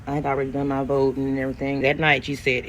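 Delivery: ordinary talk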